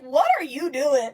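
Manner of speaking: high, drawn-out retold challenge; imitating incredulous mom. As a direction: voice mom's disbelief, high and slow. Mom confronts officer in retold scene.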